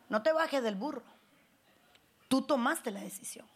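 usual speaking voice

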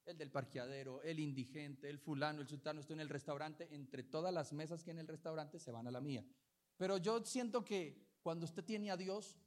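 normal talking voice

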